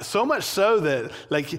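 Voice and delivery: ordinary delivery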